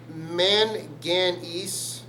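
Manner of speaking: manganese drawn out syllable by syllable; manganese sounded out. Shown as a demonstration. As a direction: stretch each syllable of manganese, slowly. Sounding out a confusable name.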